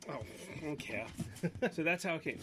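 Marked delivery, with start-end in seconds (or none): none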